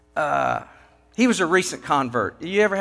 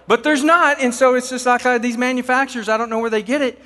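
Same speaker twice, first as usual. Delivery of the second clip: exasperated rant; 8 semitones higher, 5 dB louder than usual. Real, not acted. Speaker ranting about manufacturers.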